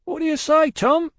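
calling back. Acted. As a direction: call up to a mate on the box.

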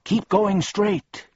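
high-pitched insistent guide; counseling voice. A narrator's reading. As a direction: urge them onward in a high voice. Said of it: "counseled the voice".

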